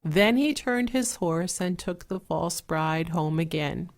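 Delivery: ordinary delivery, nothing marked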